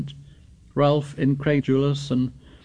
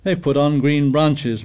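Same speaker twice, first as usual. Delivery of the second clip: plain reading voice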